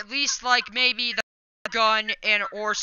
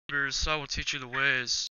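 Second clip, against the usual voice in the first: much lower, rumbling mock-mentor voice; deep mock sage voice; 11 semitones lower, 8 dB quieter than usual. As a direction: lower the voice like a cheesy wise master. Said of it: "like Chewbacca".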